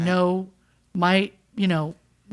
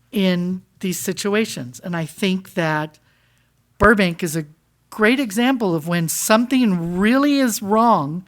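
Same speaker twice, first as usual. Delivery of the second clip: usual speaking voice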